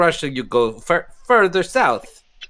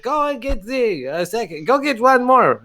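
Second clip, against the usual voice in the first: put-on higher-pitched bark to the serving boy; mock yell at the boy; 8 semitones higher than usual. Bartender yells to the serving boy in a raised voice. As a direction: call the boy over, lightly hammed. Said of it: "He yells at the serving boy".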